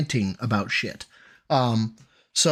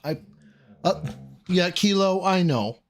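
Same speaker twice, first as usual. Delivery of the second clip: ordinary talk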